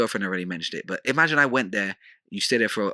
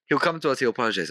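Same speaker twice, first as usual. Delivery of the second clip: ordinary talk, nothing marked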